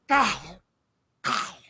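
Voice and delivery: gulping throaty swallow sound; Gollum throat gulp. Acted. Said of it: "I do Gollum impressions too".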